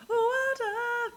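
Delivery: sudden squeak way up high; squeaky high voice. Pretend. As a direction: pop the word up into a silly squeak. Squeaky repeat of water.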